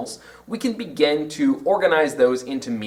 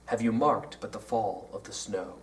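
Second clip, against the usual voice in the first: low, soft "Have you marked but the fall of the snow", every third syllable stressed; anapestic rhythm; 6 semitones lower, 9 dB quieter than usual. Shown as a demonstration. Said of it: "What happens if we have unstressed".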